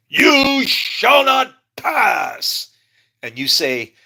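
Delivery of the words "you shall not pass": slow, booming high cry like the wizard on the bridge; hammed-up epic wizard proclamation; Gandalf. Comparing a stranger's resolve, shouting Gandalf's line. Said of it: "It's like damn Gandalf".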